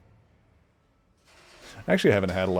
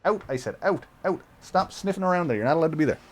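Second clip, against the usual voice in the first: scolding; 8 semitones higher, faster than usual. Real, not acted. Scolding the cat.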